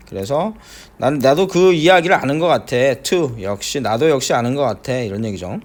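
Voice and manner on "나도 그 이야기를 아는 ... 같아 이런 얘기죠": ordinary talk, nothing marked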